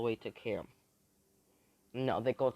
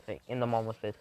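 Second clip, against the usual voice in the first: ordinary delivery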